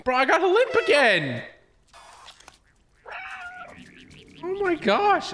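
high-pitched cry of disbelief; exasperated dismay. Real, not acted. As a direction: wail it high in frustrated disbelief. Dismay at repeated limp.

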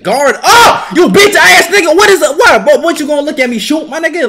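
high, loud trash-talk; loud taunt. Real, not acted. Gamer trash-talking his opponent, loud taunt. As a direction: get in his face.

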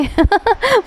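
short, high chuckle; brief laugh at the absurdity; a quick high giggle; amused laugh. Real, not acted. Amused at the misplaced blame.

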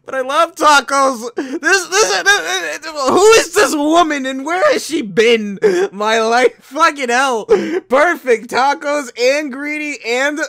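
high, loud, laughing rave; squealing delight; excited gush. Acted. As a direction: gush like you just found love. Gushing over tacos, laughing.

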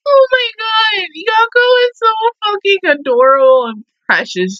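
overwhelmed fan shout; excited gushing. Real, not acted. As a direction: squeal out the love for her. Gushing over anime scene.

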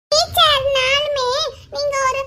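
put-on high, slow childish reasoning; arguing small boy. Boy arguing that teacher is a girl, childish voice. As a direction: draw it out like a stubborn little boy.

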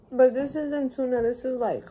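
chewing between words; talking through a mouthful; mouth full of food. Real, not acted. Seen 0: Took a forkful at -1.0; jaw and lips move mid-bite while speaking.

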